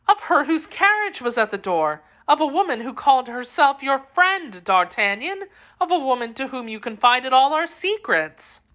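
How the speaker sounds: put-on high, joyful young woman; a lover reunited, breathless with joy; Constance's voice. A narrator's reading. Constance greets D'Artagnan at last, high and joyful. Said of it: "Constance describes the woman".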